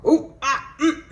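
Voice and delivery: high burst of laughter, ooh and ah. Real, not acted. Cracking up at her own spirit guides, high laughing. Laughing reaction at ancestor comment.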